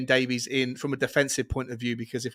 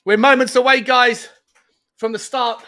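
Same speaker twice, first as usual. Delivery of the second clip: much higher and louder; excited build-up; 10 semitones higher, 10 dB louder than usual. Real, not acted. Host hyping viewers moments before kickoff. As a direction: hype up the kickoff.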